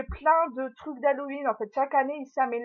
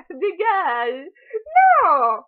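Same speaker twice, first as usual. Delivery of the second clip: loud, higher shooing of a nipping dog; telling off puppy; 6 semitones higher, 9 dB louder, much slower than usual. Real, not acted. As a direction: push the puppy away with a loud protest. Fending off her puppy, which nips her.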